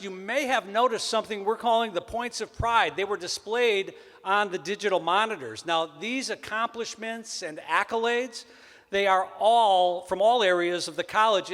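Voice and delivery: normal talking voice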